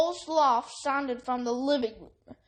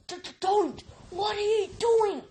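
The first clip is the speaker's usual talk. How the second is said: hissed in alarm; 8 semitones higher than usual. A narrator's reading. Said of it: "Harry hissed".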